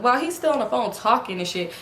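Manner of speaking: usual speaking voice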